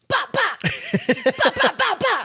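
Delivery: high, loud "bah" yelps in bursts; knife practice noises. Retold or imitated. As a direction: imitate attack sounds with loud high bahs. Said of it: "I was, like, practicing".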